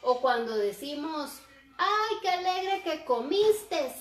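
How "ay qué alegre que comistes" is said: "comiste" said as "comistes" with an added final s; mock the common error "comiste" with an extra s, higher and slower; wrong final s. Shown as a demonstration. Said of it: "agregar la S al final está mal".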